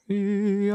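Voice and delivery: long, high sustained wail; drawn-out mock-dramatic howl; imitated dramatic wail. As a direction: mimic a swelling movie lament. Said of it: "would have filmed it like this".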